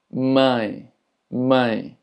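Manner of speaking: mãe with a nasal "ãe" glide; sonido nasal. Shown as a demonstration. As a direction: send the vowel of mãe through the nose. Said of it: "indica un sonido nasal". (mãe con «ãe» nasal)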